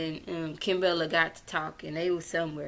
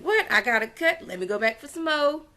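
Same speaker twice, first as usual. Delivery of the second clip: retold outrage; 8 semitones higher, 4 dB louder than usual. Erica wants to fight again.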